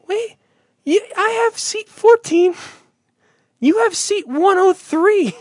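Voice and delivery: high put-on voice for a stranger; squeaky voice for the confused fan; mimicked stranger. Mimicking seatmate at ballgame.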